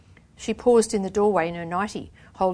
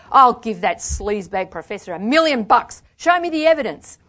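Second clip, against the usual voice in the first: raised, loud scornful dare; angry challenge; 4 semitones higher, 8 dB louder than usual. A narrator's reading. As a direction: demand proof with contempt.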